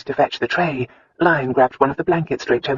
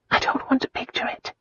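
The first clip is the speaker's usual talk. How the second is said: higher, trembling whisper; 10 semitones higher, 5 dB quieter than usual. A narrator's reading. Wife whispering, frightened, to her husband. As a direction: push the image away in a hush. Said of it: "Christina whispered".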